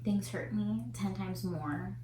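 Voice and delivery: normal talking voice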